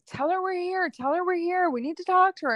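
mock-insistent spirits pushing to get through; pushy spirits. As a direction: nag her again and again to be heard. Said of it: "like, pushy".

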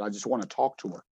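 low, soft, subdued plea; retold grieving plea. Player speaking of his late mom, subdued grief. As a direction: quote a crying player quietly. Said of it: "he was crying for a while".

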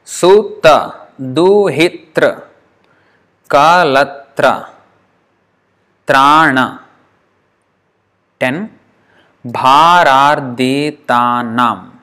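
drawn-out syllable by syllable; counting syllables aloud, slow and deliberate. Shown as a demonstration. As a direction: spell out each word slowly. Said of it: "Let's count".

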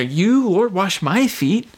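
drawn-out, rising disbelief; incredulous. Acted. Embarrassed disciple questioning his master, incredulous. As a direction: lean slowly on the words in protest.

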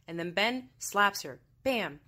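normal talking voice

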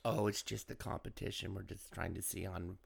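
low mumbled reply; quiet mumble. Retold or imitated. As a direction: mumble the answer under your breath. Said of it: "I asked him".

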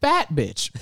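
giggling through the words; laughing. Real, not acted. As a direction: crack up mid-phrase. Laughing reading app results.

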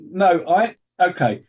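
normal talking voice

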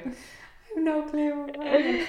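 a drawn, dreamy echo of the line; slow drawn-out repeat. Real, not acted. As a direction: stretch every word out.

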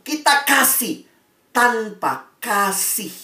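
first "Kita kasih" lifted high and held; "tanpa kasih" slowed right down to land it; stress on kasih. Shown as a demonstration. Preacher hammering home the wordplay, high and slow.

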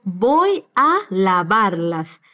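Voy a lavarlas very slowly, each word apart; slow demo. Shown as a demonstration. Teacher slowing down to show the preposition a, very slow. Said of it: "acompañado de la preposición a".

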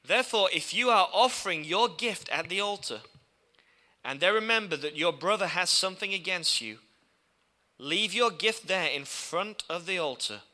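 loud, ringing scripture reading; preaching scripture. Acted. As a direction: proclaim the verse to the church. Reading scripture at full force.

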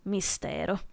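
ordinary delivery, nothing marked

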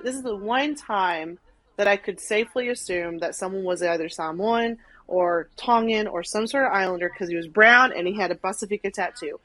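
ordinary talk

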